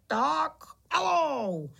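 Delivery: high, long-drawn starting call; urging on. A narrator's reading. Pytlík orders the tiny bugs to go, calling out. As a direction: send the team off, stretching each word.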